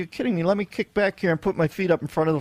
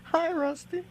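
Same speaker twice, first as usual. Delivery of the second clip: very high, softer hello to a puppet; high greeting voice; 11 semitones higher, 6 dB quieter, slower than usual. Pretend. Host greeting a puppet, high soft play voice. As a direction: greet the dummy high and small. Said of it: "give you my man voice".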